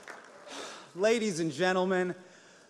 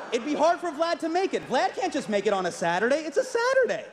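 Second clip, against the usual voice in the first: high, exasperated mock excuse; mock-obvious whine; mock explaining; 7 semitones higher than usual.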